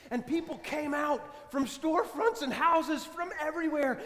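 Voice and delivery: ordinary delivery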